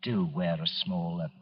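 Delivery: hushed, self-conscious confession about a hairpiece; sheepish admission. Acted. Confides wearing a hairpiece.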